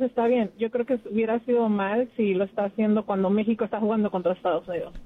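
normal talking voice

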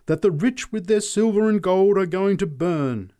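high, loud preacher's cry; shrieked. A narrator's reading. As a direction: shriek damnation at the crowd. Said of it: "she shrieked".

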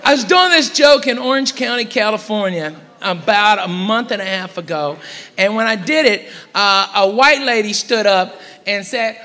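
ordinary delivery

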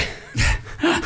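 breathless high giggle that breaks off the joke; real giggle he can't hold in; unvoiced wheezing laugh mid-story; laughing at own joke. Real, not acted. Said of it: "He's laughing at his own jokes".

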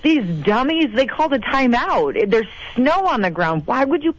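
mock disbelief at a dumb timeout; freaking out. Fan freaking out at the TV timeout, exasperated. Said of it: "I was freaking out".

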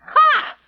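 sharp mocking bark of a laugh, high and loud; scornful ha. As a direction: spit out a contemptuous ha.

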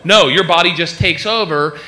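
ordinary talk, nothing marked

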